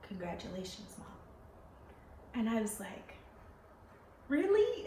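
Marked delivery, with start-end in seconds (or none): 0.0-1.1 much softer, close and hushed; a nurse quietly congratulating a new mother; said softly in her ear. Retold or imitated. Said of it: "in my ear". 4.3-4.9 much higher and louder, amazed; stunned joy at the news; disbelieving really. Retold or imitated. Told she is pregnant.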